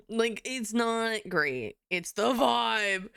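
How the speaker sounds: ordinary delivery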